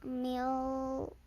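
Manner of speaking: plain reading voice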